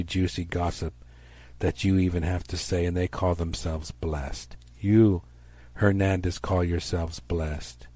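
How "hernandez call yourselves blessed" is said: normal talking voice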